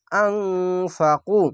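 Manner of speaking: আনফাকু read very slowly, final u held one alif; chanted Quranic reading; long u stretch. Shown as a demonstration. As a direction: draw out the last vowel of আনফাকু. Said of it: "এক আলিফ টেনে পড়বেন".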